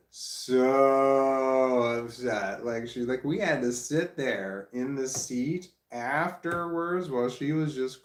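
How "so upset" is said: mock sob. Parent retelling daughter's movie grief.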